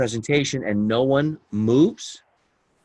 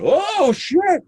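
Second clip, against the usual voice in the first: high alarmed yelp of a curse; startled curse; 4 dB louder than usual. Retold or imitated. Jumper retelling his spin, a high startled curse. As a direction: blurt it as the canopy starts spinning. Retold fright as chute spins.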